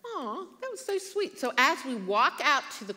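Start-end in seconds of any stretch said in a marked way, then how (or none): 0.0-1.4 touched aw. Retold or imitated. Mother thanking the cashier, high touched coo. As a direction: coo at the kind words. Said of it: "And I was like".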